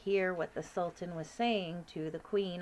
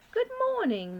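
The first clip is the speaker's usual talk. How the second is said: high, regal, louder queen voice; 5 dB louder than usual. Acted. Queen calling up to the giant, regal and high. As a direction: speak as a commanding queen.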